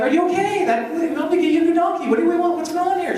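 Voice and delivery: high-pitched, baffled husband; high puzzled newlywed. Retold or imitated. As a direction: squeak out the confused questions. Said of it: "I'm like".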